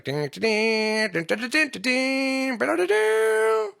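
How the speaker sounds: slow, rising mock dinging, climbing very high; jetpack dings. Mimicking jetpacks in an eighties film, rising dings. Imitating jetpack sound effect.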